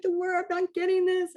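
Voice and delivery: high, whiny hammed-up complaint. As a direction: play up the despair. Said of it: "I'm like".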